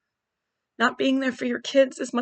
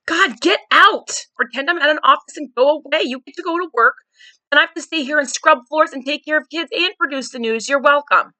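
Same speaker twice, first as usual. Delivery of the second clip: higher, louder exasperated rant at husband; retold angry outburst; 5 semitones higher, 6 dB louder than usual. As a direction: snap at him, fed up and sarcastic. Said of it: "And I was so mad".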